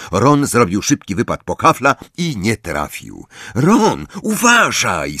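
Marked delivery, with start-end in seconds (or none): 3.5-5.2 high, short reprimand; scolding. A narrator's reading. Angelina rebuking Ron after a miss, scolding. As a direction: call out a mild telling-off. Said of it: "skarciła go Angelina".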